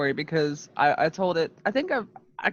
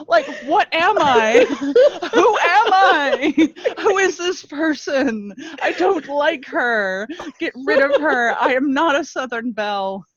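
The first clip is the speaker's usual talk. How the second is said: laughing in disbelief; 9 semitones higher, 5 dB louder than usual. Real, not acted. Laughing at herself.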